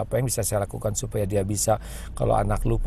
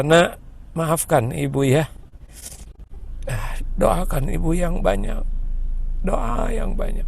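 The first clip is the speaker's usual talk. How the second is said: gentle apology; 6 semitones higher than usual. Retold or imitated. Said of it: "Kemudian bicara".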